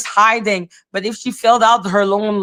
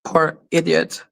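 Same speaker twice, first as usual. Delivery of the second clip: ordinary talk, nothing marked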